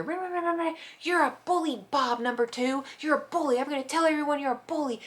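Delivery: high mock scream of the neighbor; imitated screaming. Neighbor screaming at her ex outside, imitated yelling. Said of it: "She was screaming at him".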